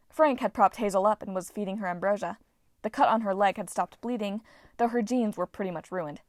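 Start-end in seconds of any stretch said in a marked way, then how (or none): none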